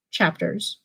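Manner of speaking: ordinary delivery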